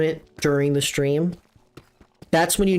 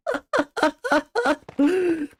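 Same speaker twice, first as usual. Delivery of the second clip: sudden high-pitched laugh; squealing laugh; high burst of laughter; 12 semitones higher than usual. Real, not acted. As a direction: crack up at the slip. Laughing at a name mix-up.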